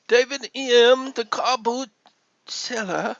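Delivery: high, slow put-on British accent. Greets David the car boot seller, high mock British accent. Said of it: "I'm using my British accent".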